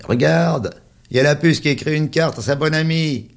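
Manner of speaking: high, loud mocking kid; teasing child voice. A narrator's reading. A boy taunting his friend about the postcard, high and loud. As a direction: jeer like a schoolboy showing off a secret.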